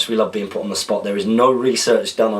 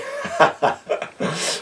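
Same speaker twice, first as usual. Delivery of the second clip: laugh; 8 semitones higher than usual. Real, not acted. Laughs after announcer bit.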